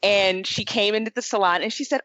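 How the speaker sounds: ordinary talk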